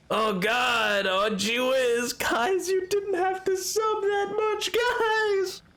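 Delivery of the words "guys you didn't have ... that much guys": pitched way up, mock-pleading at the chat; whiny high plea. Real, not acted. Streamer whining high at chat after a big gift sub. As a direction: squeak it out like a flustered protest.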